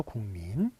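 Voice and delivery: 국민 softly sounded out as "궁민"; 국민 with its ㄱ turned to a nasal ng; nasalized pronunciation. Shown as a demonstration. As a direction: say 국민 as "gung-min", low and soft. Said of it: "이렇게 나죠".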